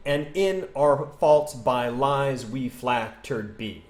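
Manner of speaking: slow, beat by beat, "flattered" as FLAT-terd; scanned iambs. Shown as a demonstration. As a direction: count out ten syllables, "flattered" in two. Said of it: "every single one's gonna have ten syllables".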